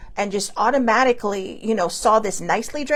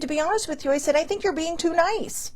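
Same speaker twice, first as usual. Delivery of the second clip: higher pitched, exasperated telling-off; retold scolding; 7 semitones higher than usual. Telling older sibling she was too nice, pitched-up exasperation.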